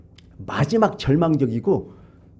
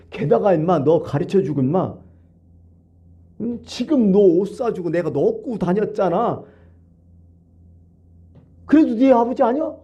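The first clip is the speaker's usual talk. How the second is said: angry father; 5 semitones higher than usual. Acted. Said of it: "아버지가 화가 나갖고".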